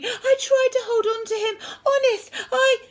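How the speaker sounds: high, loud, distraught plea; panting distress. A narrator's reading. Henry apologising for dropping his brother, high distressed panting. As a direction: plead it in a panicky rush. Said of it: "panted Henry".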